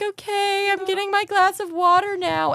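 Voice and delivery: high, exaggerated teasing brother voice; mocking brother. Imitating her brother catching her reciting at night, high teasing voice. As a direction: squeak it out like a stunned, amused big brother.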